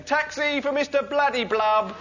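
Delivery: calling out. Retold or imitated. Minicab driver calling at door.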